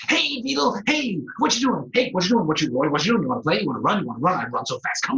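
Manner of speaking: high, loud, eager rat voice; pestering rat. Acted. Rat badgering the shy beetle, high excited voice. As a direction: bounce through it like a hyper rat.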